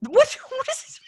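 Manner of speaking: high, squeaky disbelief; incredulous. Real, not acted. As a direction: shriek in disbelief. Incredulous outburst.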